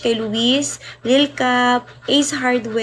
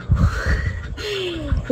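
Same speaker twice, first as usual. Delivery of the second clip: high laugh; 8 semitones higher than usual. Real, not acted. Laughing at viewers.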